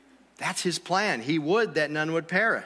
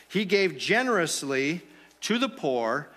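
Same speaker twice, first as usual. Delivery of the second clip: ordinary delivery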